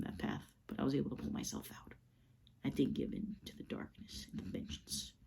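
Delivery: breathy, low whisper; hushed whisper. Acted. Bruce telling Slade he resisted, whispering. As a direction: breathe the words out. Bruce continues his speech.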